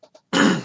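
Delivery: loud throat clear. Real, not acted. Throat clearing before move.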